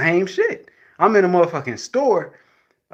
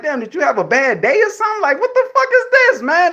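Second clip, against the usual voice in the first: much higher, louder mock outrage; exasperated disbelief; 11 semitones higher, 4 dB louder than usual. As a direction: act out the shock at her rudeness. Acted exasperated quote at woman.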